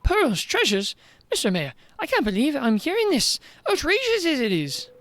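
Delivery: put-on high, indignant young girl; girl shocked at the mayor's greed, high and indignant; outraged girl. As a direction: rise up in disbelief as the girl.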